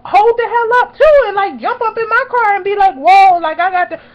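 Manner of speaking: high, loud startled exclaiming; hammed-up alarm at waiting strangers; alarmed reaction. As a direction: act out being startled and backing off. Acting out her own alarmed reaction.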